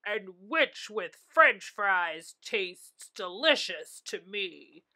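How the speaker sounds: menacing roaring dragon voice; scary dragon roar. A narrator's reading. A dragon threatening to eat the witch, scary roar. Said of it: "a roar that was scary and loud".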